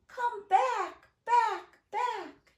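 high-pitched, pleading-style singsong call; child calling out. Acted. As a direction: call each word after lost balloons. Child calling balloons back, last time.

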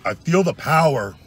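slow, pumped-up declaration; hammed-up surge of power; dramatic power voice.

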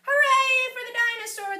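put-on cheering children. A narrator's reading. Kids cheering for the dinosaur who won hide and seek, excited shout. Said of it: "the kids cried".

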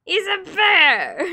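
loud, slow fake-sobbing yell; a hammed-up tearful shout; mock crying shout. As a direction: wail it out in hammed-up outrage. Defending her bear drawing playfully.

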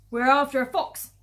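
very loud, forceful reply; shouted answer. Acted. Farmers in the crater to the villagers, shouting back.

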